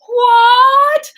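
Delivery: scandalised high-pitched "What?"; shocked shriek. Acted. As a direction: shriek it as an outraged mother. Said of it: "Missus Ferris is like".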